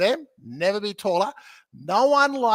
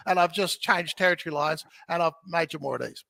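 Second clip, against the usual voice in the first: usual speaking voice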